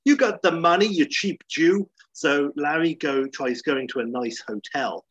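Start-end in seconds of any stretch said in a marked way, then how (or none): 0.1-1.9 higher, louder jab, dragged out at the end; a hint of a taunting friend; mock Lewis. Lewis taunting Larry on the phone, mildly mock-voiced. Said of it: "You know".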